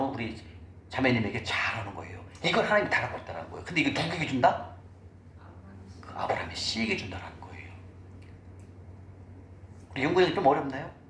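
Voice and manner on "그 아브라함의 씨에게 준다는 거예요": breathy unvoiced whisper to the congregation. Real, not acted. Preacher to congregation, revealing who receives, whispered. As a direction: let the answer drop to a whisper.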